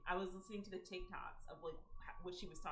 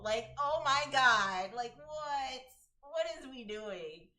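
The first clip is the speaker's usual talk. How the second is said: disbelief; 4 semitones higher, 10 dB louder, slower than usual. Real, not acted. Disbelief at the story.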